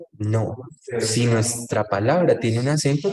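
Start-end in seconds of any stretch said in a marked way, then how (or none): none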